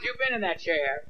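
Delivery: ordinary talk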